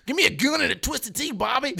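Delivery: loud, high mock country holler; country accent. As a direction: holler it like a hammed-up country boy. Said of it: "like, a country accent for it".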